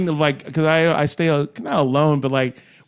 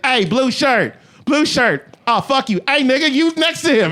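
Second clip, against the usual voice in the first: higher-pitched calling out to passers-by; imitated street hollering; 8 semitones higher than usual. Club promoter hailing men at two in the morning, imitated hollering. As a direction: holler after someone, playing the promoter.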